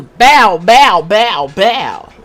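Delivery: booming imitated bow bow shots; loud playful chant; loud mock bow bow. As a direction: belt out the taunt like a crowd hype noise. Mimicking a wrestler's finishing taunt.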